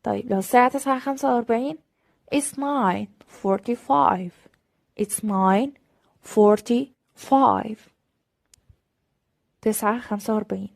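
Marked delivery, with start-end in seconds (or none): 2.4-7.8 slow, clear teacher demo; deliberate classroom pacing; slow model reading. Shown as a demonstration. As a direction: say it slowly for learners. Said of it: "slow model".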